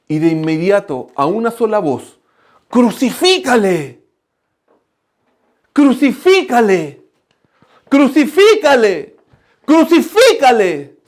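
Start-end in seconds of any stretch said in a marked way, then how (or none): 2.7-11.0 slow, rising crowd shout, higher and louder each time; crowd yelling crucify. Retold or imitated. Preacher voicing the mob against Jesus, slow swelling shout. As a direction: build the mob's cry from loud to a full yell. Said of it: "Dos mil personas gritando".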